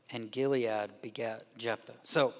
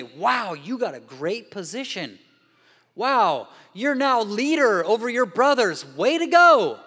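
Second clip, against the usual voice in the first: much higher, very loud mock cheer; sarcastic congratulations; 7 semitones higher, 11 dB louder than usual. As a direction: congratulate him bitterly, overblown.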